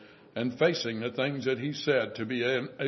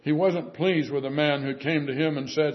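ordinary delivery

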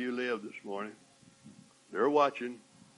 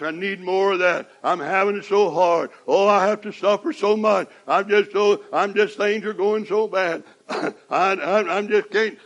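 louder, higher whiny mock pleading; whining prayer; 5 semitones higher, 7 dB louder than usual. Preacher mocking a complaining prayer, whiny. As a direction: ham up a self-pitying prayer.

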